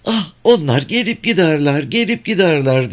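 high airy woman's voice; Mrs. Phelps. A narrator's reading. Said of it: "dedi Bayan Phelps".

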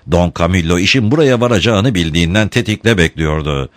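ordinary delivery

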